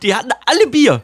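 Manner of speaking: high, loud joking line; excited. Acted. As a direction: deliver the joke with glee. Joking punchline.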